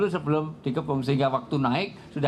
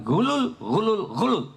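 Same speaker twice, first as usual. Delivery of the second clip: high, loud repeated cry of condemnation; retold loud denouncing; 10 semitones higher, slower than usual. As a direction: denounce it for the whole town to hear. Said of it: "Kenceng waktu itu".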